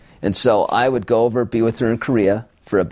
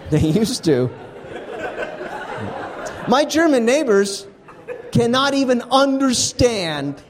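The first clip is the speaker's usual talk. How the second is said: high, lively stage delivery for a laughing crowd; comic on stage, raised and bright; stand-up comedy set. Acted. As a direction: sell each punchline to the room. Stand-up set before interview.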